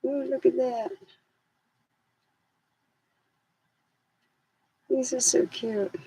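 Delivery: high cutesy voice for the dogs; squeaky pet voice gushing over socks; dogs' voice. Retold or imitated. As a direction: coo like an excited little dog. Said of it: "They're like".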